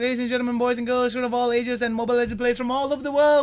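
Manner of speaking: high, fast, breathless announcer intro; all in one breath. Acted. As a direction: rattle it off without a breath. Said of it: "I did that all in one breath".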